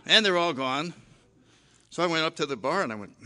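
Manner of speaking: normal talking voice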